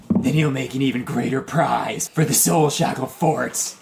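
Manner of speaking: spiny devil. Acted. Devil promising prizes for the fort, monster voice. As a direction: gloat like a fiend overhead. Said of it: "you just hear him say".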